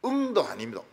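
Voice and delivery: usual speaking voice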